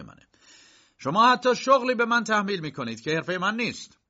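firm, sharp defiance. A narrator's reading. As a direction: rebuke the court, firm and cutting. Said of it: "با لحنی تند و محکم گفت".

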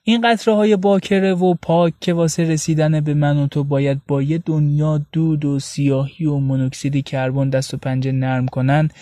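usual speaking voice

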